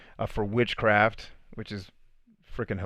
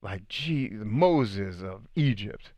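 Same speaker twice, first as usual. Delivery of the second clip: slow, tired lament, lightly voiced; frustrated Jesus; 3 dB quieter, slower than usual. Jesus fed up with his faithless followers, slow and weary. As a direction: drag the words out in weary frustration. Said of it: "Jesus is at the point of frustration".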